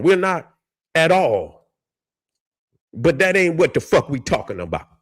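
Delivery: usual speaking voice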